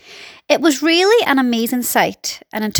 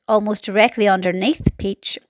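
usual speaking voice

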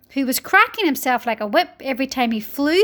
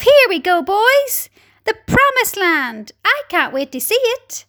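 excited shouts; 7 semitones higher than usual. A narrator's reading. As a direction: call out like an eager crowd setting off. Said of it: "excitement and shouts of".

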